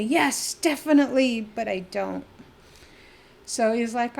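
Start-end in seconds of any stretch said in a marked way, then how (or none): 0.0-1.4 louder, higher emphatic burst; mock shout of wholehearted agreement; eager shout. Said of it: "I just wanna shout out and say".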